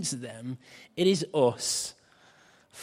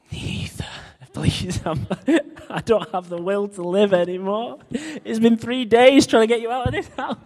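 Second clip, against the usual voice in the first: exasperated laughing plea; 8 semitones higher, 6 dB louder than usual. Retold or imitated. Weary dad pleading, laughing.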